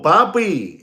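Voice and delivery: ordinary talk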